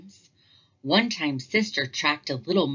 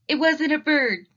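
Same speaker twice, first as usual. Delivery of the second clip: blurting confession; 10 semitones higher, 6 dB louder than usual. Acted. Cubs blurt the truth.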